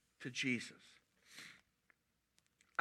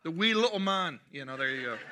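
Scottish accent; 7 dB louder than usual. Shown as a demonstration. Preacher indulging an urge mid-sermon, Scottish accent. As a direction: burst out in a playful Scottish accent. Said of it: "say that in a Scottish accent".

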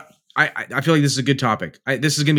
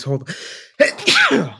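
normal talking voice

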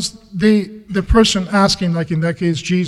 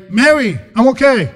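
higher, louder cheery hail; calling out; 5 semitones higher, 6 dB louder than usual. Acted. Imagined Jesus hails Mary after rising, calling out. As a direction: call it out as a casual announcement.

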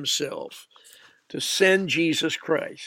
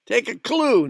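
mock exasperated retort; 7 semitones higher, 5 dB louder than usual.

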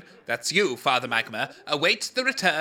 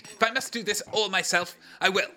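ordinary delivery

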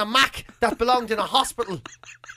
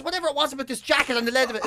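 ordinary talk

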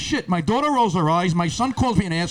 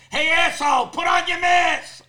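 angry shout; 10 semitones higher, 4 dB louder than usual. Acted. Lady in line ordering him to mask up, angry shout. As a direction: bellow the order, high and stretched.

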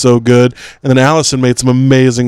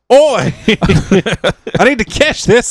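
high, hammed-up Australian accent; a clumsy Australian accent with an "Oi!" up front; poor Australian impression on purpose; bad Australian accent; 6 semitones higher than usual. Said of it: "I don't do a very good Australian".

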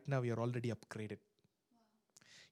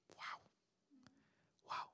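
ordinary talk, nothing marked

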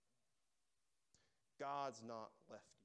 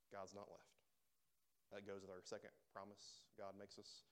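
ordinary talk, nothing marked

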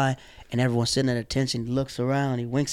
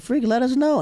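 excited; 11 semitones higher than usual. Retold or imitated. His excited reply.

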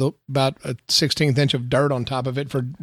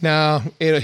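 usual speaking voice

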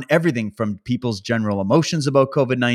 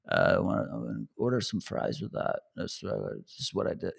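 much lower, much softer throaty mumble; mid-throat mumble; 9 semitones lower, 12 dB quieter than usual. Retold or imitated. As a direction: imitate a mumbling fry-order with no projection. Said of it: "They're like".

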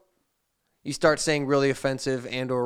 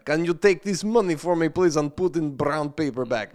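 light put-on Russian accent, no articles; mock Russian accent; 3 semitones higher than usual. Scammer asking him to hold money, mock Russian accent. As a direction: read the broken English like a Russian scammer. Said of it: "if you read it in Russian".

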